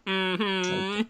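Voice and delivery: high, far sassy mm-hmm. Retold or imitated. Host imitates the sassy woman's mm-hmm. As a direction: play the finger-wagging sass.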